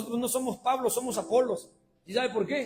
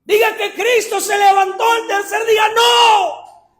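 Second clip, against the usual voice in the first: loud, high preached challenge; full-force preaching; 11 semitones higher, 13 dB louder, slower than usual. Acted. Pastor challenging the demon before the congregation, shouted. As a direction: hurl the challenge at the devil.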